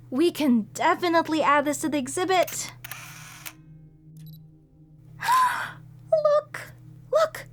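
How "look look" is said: high, eager gasping wonder; excited discovery. Acted. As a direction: point it out thrilled. Discovering pathways.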